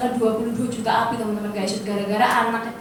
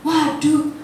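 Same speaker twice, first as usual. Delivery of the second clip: ordinary talk, nothing marked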